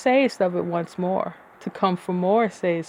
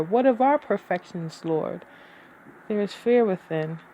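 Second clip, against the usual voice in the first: plain reading voice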